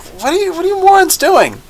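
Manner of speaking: very high, squeaky disbelief; hammed-up baffled squeal at idiots; incredulous reaction. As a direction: shoot the pitch way up in mock outrage. Acting own baffled reaction to show.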